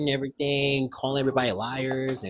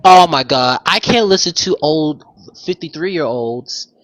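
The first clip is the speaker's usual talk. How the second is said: exasperated outburst; 4 semitones higher, 10 dB louder than usual. Real, not acted.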